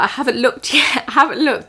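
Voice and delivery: high, laughing nervous denial. Retold or imitated. Telling her friend she hasn't looked, laughing nervously. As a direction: giggle it out, too scared to look. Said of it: "'cause I was so scared".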